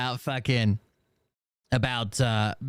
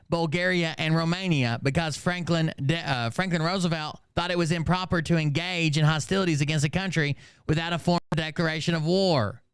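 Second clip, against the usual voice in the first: steady, slightly raised read-aloud voice; reading a post aloud; 6 semitones higher than usual. Acted. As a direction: recite his written response, evenly paced. Reads his written response aloud.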